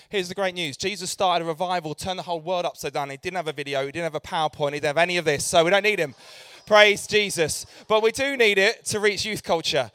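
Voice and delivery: loud, rousing preacher's declaration; a joyful, loud pulpit proclamation; fired-up preaching. Acted. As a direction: preach it to the room at full force. Preaching after video fails.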